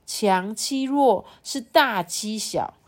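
high, slow suspense narration; dramatic storytelling. A narrator's reading. Parent reads the storm scene to a child, dramatic storytelling. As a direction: stretch the words out high for drama.

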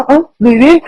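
high surprised "ah"; surprised exclaim. Retold or imitated. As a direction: squeal in surprise at them. Retold boss reacting to lockout.

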